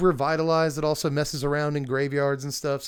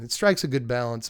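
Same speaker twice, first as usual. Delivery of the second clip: ordinary talk, nothing marked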